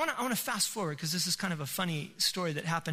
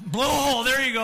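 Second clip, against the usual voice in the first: loud, raised burst of sudden remembering; triumphant recall; 5 semitones higher, 9 dB louder than usual. Real, not acted. Preacher finds the forgotten name of the spout, triumphant. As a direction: blurt out the word you were hunting for.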